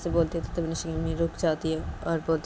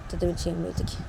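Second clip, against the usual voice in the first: usual speaking voice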